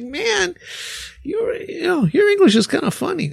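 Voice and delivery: high voice through laughter; amused retelling. Retold or imitated. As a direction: squeak it out, tickled by the memory. Retold remark to student, laughing.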